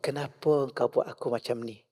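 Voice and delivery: soft, hushed plea to God; a humble, softened supplication; quiet prayer. Retold or imitated. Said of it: "Tanya Allah".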